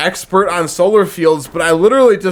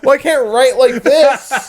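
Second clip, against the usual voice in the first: slow, high, laughing complaint; laughing mock complaint; 6 semitones higher, slower than usual. Worker laughing as he tells his friend about the rain. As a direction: laugh while whining about wet paper. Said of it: "it's like".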